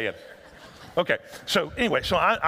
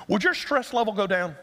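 ordinary talk, nothing marked

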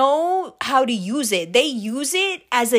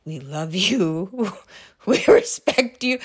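slow mock-soothing voice, breaking into a laugh; syrupy imitation, laughing midway; dad's placating routine; 5 semitones lower, slower than usual. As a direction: tease dad with his own drawn-out reassurance. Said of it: "Did you do your".

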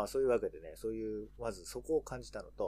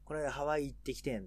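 put-on Kansai comedian's accent, higher, ワ of ハワイ prominent; 関西弁; 4 semitones higher, 4 dB louder than usual. Showing co-host how Kansai comedians talk, Kansai accent. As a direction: imitate Kansai dialect, lifting ハワイ then falling. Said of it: "こういう風に関西芸人とか言うのよ".

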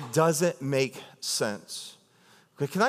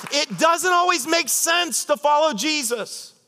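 higher and slow over music; slow high sermon peak; preaching climax; 10 semitones higher, 3 dB louder, slower than usual. Acted. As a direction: lift it into the climax.

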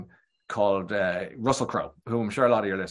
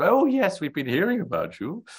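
refined lady's voice; 8 semitones higher than usual. Retold or imitated. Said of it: "[name], a real lady".

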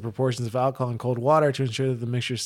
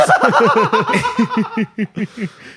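big laugh; 8 dB louder than usual. Real, not acted. Laughing at gummy bear plan.

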